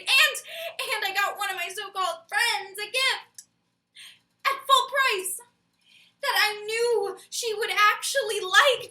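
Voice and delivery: loud, high, appalled emphasis; comic outrage. Acted. Confessing to a partner about buying a friend gifts, comic outrage. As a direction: build the exasperation with heavy stressed words. Exasperated list of good deeds.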